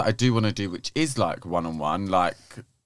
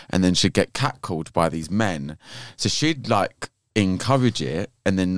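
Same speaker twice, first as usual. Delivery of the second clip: normal talking voice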